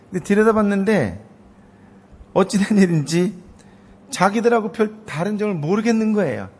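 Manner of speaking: high, loud preaching climax; impassioned preaching. Acted. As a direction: build it up before the crowd. Preaching at full force. (열정적인 설교, 높고 크게)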